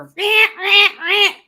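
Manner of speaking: high, loud repeated noise; imitated alert noise. Woken on Sunday morning, imitating the noise. Imitating sound heard while asleep.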